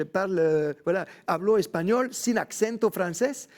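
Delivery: proud, loud switch into Mexican Spanish; Mexican Spanish accent. Retold or imitated. The Frenchman married to a Mexican showing off his Mexican Spanish accent. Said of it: "j'ai pris l'accent mexicain".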